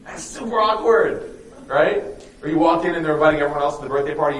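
ordinary talk

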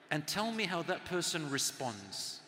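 ordinary delivery

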